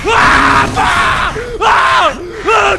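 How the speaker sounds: repeated loud high screams; panicked screaming. Real, not acted. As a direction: scream over and over under attack. Grabbed by enemy.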